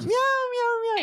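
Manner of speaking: very high squeaky meow; high cat meow. Pretend. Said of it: "You gotta do a neko voice".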